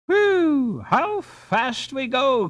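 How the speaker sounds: high, thrilled exclamation, slowing on the second line; excited cry. A narrator's reading. Ben on the speeding ice boat, thrilled. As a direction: call out the speed with delight. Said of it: "cried Ben".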